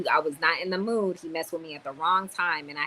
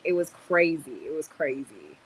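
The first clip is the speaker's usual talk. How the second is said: normal talking voice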